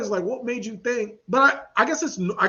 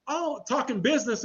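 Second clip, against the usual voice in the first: normal talking voice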